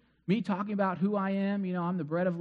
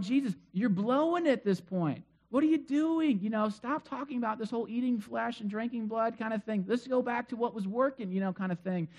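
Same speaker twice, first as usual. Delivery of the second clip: higher, pleading to change course; alarmed disciples; 3 semitones higher than usual. Retold or imitated. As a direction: urge Jesus back to crowd-pleasing. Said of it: "they're going".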